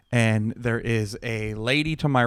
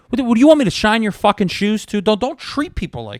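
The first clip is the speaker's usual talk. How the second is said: higher, louder imagined blowup; the comeback I wanted to yell, pitched up; mock angry outburst, louder; pretend snap; 8 semitones higher, 4 dB louder than usual. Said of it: "I did wanna snap the fuck out".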